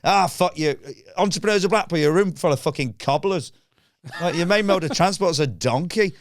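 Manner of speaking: high, scornful mock rant; comic indignant outburst; retold parting tirade. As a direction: hurl insults at the whole room. Acts insulting the booing room.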